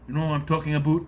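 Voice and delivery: lightly put-on Canadian accent; mock Canadian accent. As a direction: lean into Canadian vowels on "about". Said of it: "she sounds Canadian".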